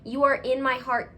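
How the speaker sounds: usual speaking voice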